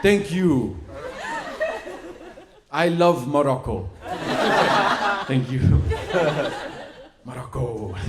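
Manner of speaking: put-on slow, halting foreign-accented English; hammed-up foreign accent, word by word. Nephew working base words on Moroccan family, put-on foreign accent. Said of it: "what is that racist accent you're doing".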